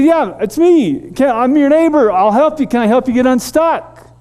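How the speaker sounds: high, loud friendly call. Retold or imitated. Offering help across the trees, a loud high call. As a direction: keep calling across to him, loud and high.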